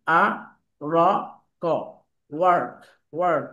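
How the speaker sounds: আ, র, ক spoken apart, then ওয়ার্ক with a thick r; American r, spelled out; American sounding out. Shown as a demonstration. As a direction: build ওয়ার্ক sound by sound, keep the r. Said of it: "এটা হচ্ছে American".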